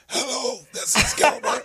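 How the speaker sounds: voiceless croak on the phone; croaky whisper. Retold or imitated. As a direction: whisper with no voice left. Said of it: "I'm on the phone like".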